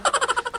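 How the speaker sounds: quick, high-pitched little chuckle; small self-amused titter; soft high giggle. Real, not acted. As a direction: giggle quietly at your own joke. Laughs at own clown joke.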